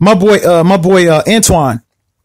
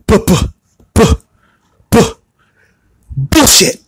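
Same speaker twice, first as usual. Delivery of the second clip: imitating her stammer; slower than usual. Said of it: "has a speech impediment".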